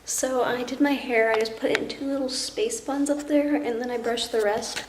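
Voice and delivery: normal talking voice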